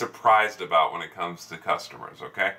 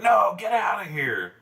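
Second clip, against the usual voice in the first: high, slow mock-angry dismissal; drawn-out retold outburst, pitched up; imitated anger; 11 semitones higher than usual. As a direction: imitate someone mad all over again. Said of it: "got mad again".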